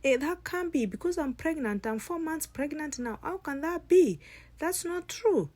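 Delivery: high, loud, frantic protest; distraught denial. Acted. Pregnant bride refuses to believe it.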